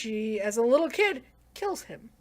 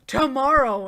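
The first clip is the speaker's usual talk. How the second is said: loud, raised mock shout; retold scream; 4 semitones higher, 10 dB louder, slower than usual. She demands a time from him, mock scream. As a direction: burst out louder and higher on the word. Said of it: "she screams that out".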